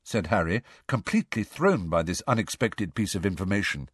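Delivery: ordinary delivery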